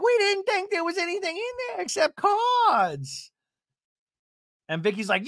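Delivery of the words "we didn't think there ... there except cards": very high, whiny protest; hammed-up hysterical complaint; mock panicked wail. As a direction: imitate a reality star freaking out. Acting Gina panicking over crickets.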